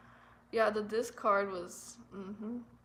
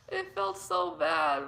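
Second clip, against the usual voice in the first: pained repeat; 4 semitones lower, 6 dB louder, slower than usual. Real, not acted.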